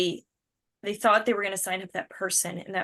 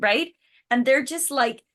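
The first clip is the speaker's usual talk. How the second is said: usual speaking voice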